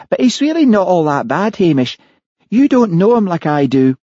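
voicing the wife on the recording; Mary's voice. A narrator's reading. Mary voiced in narration.

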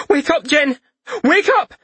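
high, loud desperate cry; panicked shout. Acted. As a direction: yell to wake him, frantic. Marissa shakes fallen Jin.

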